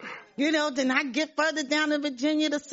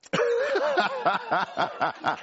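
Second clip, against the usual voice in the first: bright high laugh of relief; joyful laugh; 11 semitones higher than usual. Real, not acted. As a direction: burst out laughing with joy. Realizes she can see.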